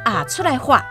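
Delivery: normal talking voice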